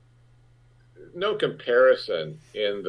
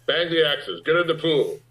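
retold yell; 7 semitones higher than usual. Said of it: "Cosmatos would yell".

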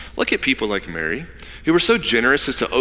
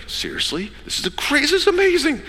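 stoked. Retold or imitated. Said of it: "And she's stoked".

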